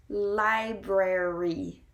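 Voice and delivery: library drawn out with both R's sounded; slow pronunciation demo. Shown as a demonstration. Teacher introducing the first word, slow clear library.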